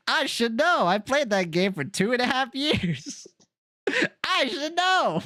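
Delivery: high put-on voice, playfully mocking; teasing femmy voice. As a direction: tease in a high, exaggerated femmy voice.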